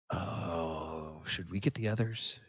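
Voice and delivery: low, soft, hushed voice; hushed in-character aside; hushed worry. Acted. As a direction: quietly ask a companion, unsure. Character hesitates after hearing prisoners.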